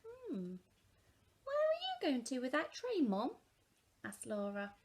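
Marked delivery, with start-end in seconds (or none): 0.0-3.4 put-on high, curious child asking; child's voice. A narrator's reading. Laura asking Mum where she's going, high child voice. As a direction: pipe up like a small girl.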